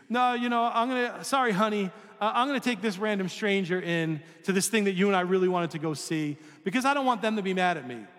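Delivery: brushing off the spouse, lightly acted sheepish apology; sheepish excuse. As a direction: apologize to the spouse while caving to the stranger. Acted reply refusing spouse.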